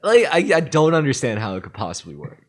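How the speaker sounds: laughing through words; can barely talk for laughing. Real, not acted. Laughing while talking.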